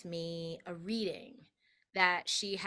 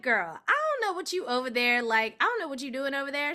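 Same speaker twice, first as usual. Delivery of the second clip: 1 sassy friend; 6 semitones higher, 7 dB louder, faster than usual. Retold or imitated. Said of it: "she was like".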